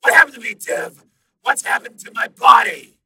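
urgent and accusing; demanding program. A narrator's reading. Program demanding about its body, urgent.

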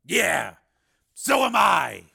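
Steele voice. Acted. Steele bitter.

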